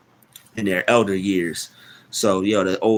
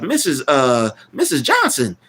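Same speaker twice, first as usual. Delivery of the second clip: higher, louder imitated cartoon call; mock calling out; 6 semitones higher, 4 dB louder than usual. Cartoon kids calling to an old woman, mock alarm.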